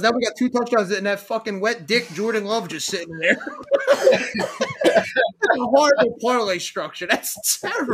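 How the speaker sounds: talking through laughter; laughing. Real, not acted. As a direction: laugh while you say it. Laughing at terrible parlay.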